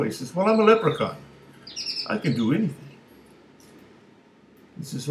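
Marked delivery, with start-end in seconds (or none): 0.3-2.9 high boastful little-man voice; leprechaun voice. Acted. Leprechaun boasts to the big guy, high and cocky. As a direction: squeak it up and brag.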